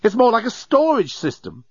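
high, scornful exasperation; raving. A narrator's reading. Journalist finishing his complaint about the jam, raving. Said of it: "The journalist was raving by now".

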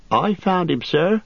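high, eager servant voice; Nob's voice. Acted. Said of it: "put in Nob".

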